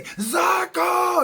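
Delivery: high, loud stadium-announcer call of the name; announcer's shout. Retold or imitated. Announcer hyping the crowd with a loud call. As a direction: bellow the rider's name like a PA announcer. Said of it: "he would say".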